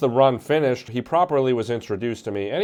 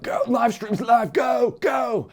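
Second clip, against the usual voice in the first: high urgent shout of go go go; frantic stage crew yelling go; 12 semitones higher, slower than usual. Retold or imitated. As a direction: hurry him out like a frantic crew member. Crew rushing him onto golf cart.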